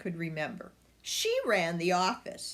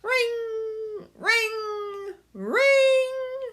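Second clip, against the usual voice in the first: high bell rings getting louder; ringing phone; 7 dB louder, much slower than usual. A narrator's reading. Narrator voicing the phone ringing, rising louder. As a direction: ring it higher and louder each time.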